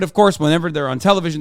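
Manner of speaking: usual speaking voice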